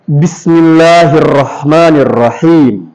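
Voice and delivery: "Bismillahirrahmanirrahim" drawn out with long vowels; slow, stretched "Bismillahirrahmanirrahim"; recitation for repeating. Shown as a demonstration. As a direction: lead the class slowly through "Bismillahirrahmanirrahim", falling at the end. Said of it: "coba bilang semuanya".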